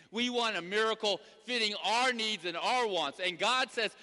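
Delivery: loud, high sermon delivery driving every phrase; preaching at full force. Acted. Pastor exhorting the congregation, full preaching voice. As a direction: preach it to the back pew.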